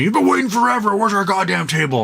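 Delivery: high, strained angry-customer impression; mock an impatient man's tirade; hammed-up irate complaint; freaking-out customer. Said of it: "freaking out and being a dick".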